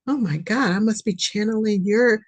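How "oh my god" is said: ordinary talk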